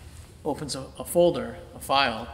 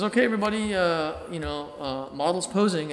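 raised, higher teacher call to the room; teacher announcing; 5 semitones higher, 5 dB louder than usual. Retold or imitated. Teacher addressing the life drawing class, raised call.

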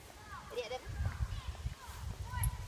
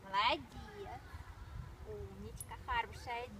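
soft praise; 12 dB quieter, faster than usual. Real, not acted. Soft praise to dog Eva.

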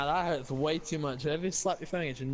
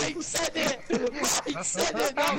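high, louder squeal through real laughter; laughing hard; 12 semitones higher, 4 dB louder, slower than usual. Real, not acted. Friend blaming Mike for the joke, laughing hard. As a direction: blurt it out while cracking up. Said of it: "That fucking laugh is stupid".

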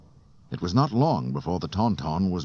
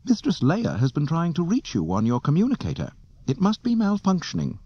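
high, prim imitation-human robot voice; fussy high-pitched droid delivering a message; C three P O; 11 semitones higher than usual. A narrator's reading. Droid relaying a message to Solo, prim and high. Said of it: "equipped with an imitation human voice".

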